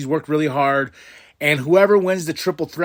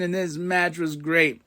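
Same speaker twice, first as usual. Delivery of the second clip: ordinary talk, nothing marked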